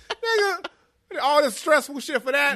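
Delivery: loud high indignant outburst; outraged. Retold or imitated. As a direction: yell it in disbelief. Acted outrage at ending.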